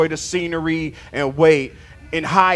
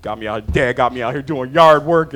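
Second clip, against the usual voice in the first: put-on sulky child; 8 semitones lower than usual. Preacher mimicking himself as a sulking child doing yard work. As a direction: grumble like a pouting kid. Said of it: "I'm like a child".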